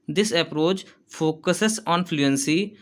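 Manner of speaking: slow, careful dictation pace, said twice; teacher dictating notes. Shown as a demonstration. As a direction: read it slowly so students can write. Teacher dictating an English point.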